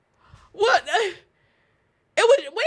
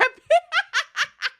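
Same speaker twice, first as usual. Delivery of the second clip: high cackle; 11 semitones higher than usual. Real, not acted. Picturing a cat jumping at a sandwich, high cackle. Laughs at the gif idea.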